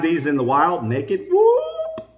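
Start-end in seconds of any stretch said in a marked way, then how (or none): 1.3-2.1 a playful, delighted squeal at the find; excited whoop. Real, not acted.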